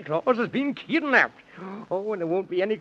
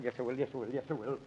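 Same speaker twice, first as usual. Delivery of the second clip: much softer, lower self-urging; muttered resolve; 9 semitones lower, 13 dB quieter, much faster than usual. Acted. Man in the Moon nerves himself to open the door, muttering. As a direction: talk yourself into it under your breath.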